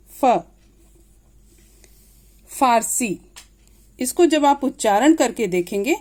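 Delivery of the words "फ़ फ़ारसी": फ and फारसी with f, teeth on lip; dotted f. Shown as a demonstration. Teacher shows teeth meeting lip, फारसी starting with f. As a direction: say "fa, faarsi" with a clear f. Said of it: "ऊपर के दांत और नीचे के होंठ".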